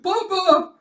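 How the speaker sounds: very high, loud squeal; mock alarmed cry. Daughter seeing her papa fall, high mock cry. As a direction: squeal it in hammed-up panic. Child cries out as father falls.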